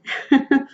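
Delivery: quick bright laugh; a brief amused laugh; short laugh. Real, not acted. Laughs after her own remark.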